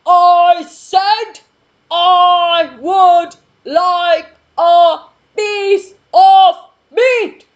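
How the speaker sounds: very loud, high, slow shout; booming shout. Acted. Mister Noisy shouting his order again at the butcher. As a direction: shout it at full size.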